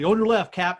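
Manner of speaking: the same call, now coming through clearly; clearer call. Retold or imitated. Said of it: "you hear it more clearly".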